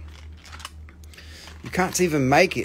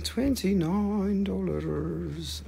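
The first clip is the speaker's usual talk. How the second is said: slow drawn out price; 3 semitones higher, 6 dB quieter, much slower than usual. Acted. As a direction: stretch twenty-nine dollars way out slowly. Drawn out, odd voice.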